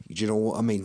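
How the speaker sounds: normal talking voice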